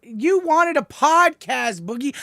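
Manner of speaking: high, loud, drawn-out pleading; exasperated reproach. Real, not acted. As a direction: throw it back at them, slowly and loudly. Exasperated reproach to Boogie mid-argument.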